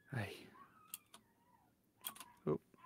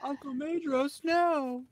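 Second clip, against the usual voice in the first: high-pitched mock child's plea; pleading child; 13 semitones higher, much slower than usual. Joking as the abandoned nephew to Uncle Maedhros, high mock plea. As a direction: playfully cry out in a much higher voice. Acting the child left behind.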